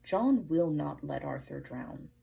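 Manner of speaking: ordinary talk, nothing marked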